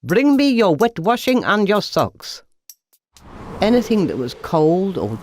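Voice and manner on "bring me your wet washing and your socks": calling out. A narrator's reading. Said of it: "he called from across the hall".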